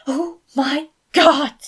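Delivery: loud, high, slow squeal of excitement; overwhelmed fan losing it; gushing. Acted. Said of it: "Felix gushed".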